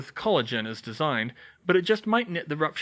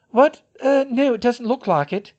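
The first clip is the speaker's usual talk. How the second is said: flustered; 11 semitones higher, 7 dB louder than usual. A narrator's reading. Molly flustered.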